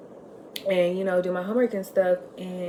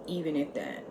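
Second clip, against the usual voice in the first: usual speaking voice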